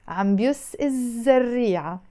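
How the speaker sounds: الزريعة opening on a long buzzing double Z. Shown as a demonstration. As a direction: link عم بيس straight into the zz of الزريعة. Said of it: "it becomes a double Z". (hold the doubled Z starting الزريعة)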